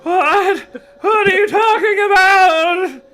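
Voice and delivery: very high, loud, slow shriek. Acted. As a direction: shriek it out in panic. Carly Beth shrieking, narrator laughs.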